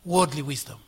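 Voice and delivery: ordinary delivery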